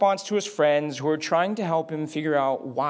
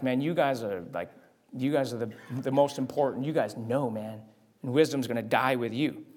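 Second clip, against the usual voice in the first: sarcastic; 5 semitones lower, 5 dB quieter than usual. Retold or imitated. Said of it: "Job has this bit of sarcasm".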